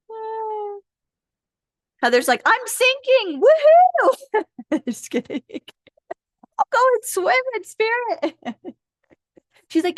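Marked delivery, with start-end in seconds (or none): 2.4-4.8 high-pitched comic cry building to a loud woohoo; mock gleeful whoop. Joking acted quote of Heather. 6.6-8.4 high, comic put-on voice; playful mock voice. Joking acted quote of Heather.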